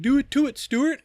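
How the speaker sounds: high, loud taunting call; mock menacing sing-song. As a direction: sing it out, half-teasing. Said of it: "I'm over here like".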